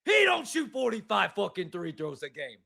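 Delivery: mock New York caller, disgusted; New York caller rant.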